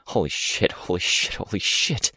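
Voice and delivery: panicked breathy whisper; breathless whisper. A narrator's reading. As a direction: gasp it under your breath. Panicked inner repetition, unvoiced.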